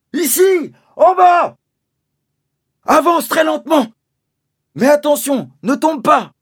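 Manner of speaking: high loud directions; father in a pit guiding his son, shouting warnings; calling warning. Acted. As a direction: guide him carefully with shouts.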